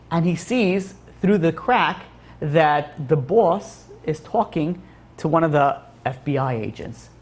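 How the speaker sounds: ordinary delivery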